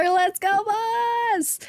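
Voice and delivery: high, rising hype cheer; excited cheer. Real, not acted. Streamer hyping up a viewer in chat, excited cheer. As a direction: draw it out high like a hype call.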